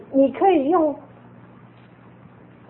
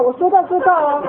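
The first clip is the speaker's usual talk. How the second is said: mock stern, louder promise; firm warning; 7 semitones higher, 5 dB louder than usual. Shown as a demonstration. Mother shows parents how to warn a child firmly. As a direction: lay down the law to the child. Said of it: "你都先给他这样吓唬嘛".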